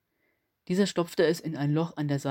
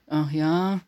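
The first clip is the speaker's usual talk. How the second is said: dark, hoarse and sleepy; lazy grunt of a scruffy boss; hoarse alien commander; 4 dB louder than usual. A narrator's reading. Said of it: "Die dunkle, etwas heisere Stimme".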